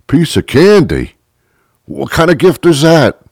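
mock outraged friend getting candy; indignant recipient. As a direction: scoff at a pathetic gift. Voicing disappointed recipient.

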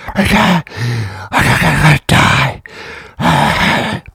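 high, strangled gasps, too winded to talk; acted breathless collapse. Exhausted patient answering testers on the treadmill, hammed-up breathlessness. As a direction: choke the words out on empty lungs. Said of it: "I can't even talk".

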